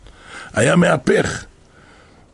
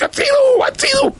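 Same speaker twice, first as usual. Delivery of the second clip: very high, squealed scream; panicked cry for help. Acted. King dreams Haman chases him with a sword, panicked cry. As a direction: shriek it at the top of the pitch range. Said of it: "הוא צועק".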